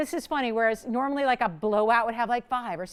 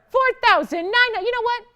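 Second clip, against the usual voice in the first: higher and louder, building excitement; price-drop hype; 12 semitones higher, 7 dB louder than usual. Real, not acted. Host dropping the ring price for viewers, excited. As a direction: tease the next drop.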